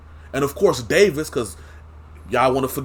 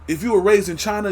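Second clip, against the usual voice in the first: ordinary talk, nothing marked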